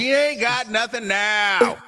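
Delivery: incredulous retort. Real, not acted.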